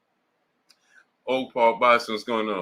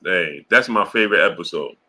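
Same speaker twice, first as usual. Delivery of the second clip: ordinary talk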